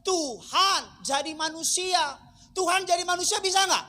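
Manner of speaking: loud, high-pitched sermon delivery; full-force preaching. Acted. Preacher correcting the congregation on what God can become, full-force preaching.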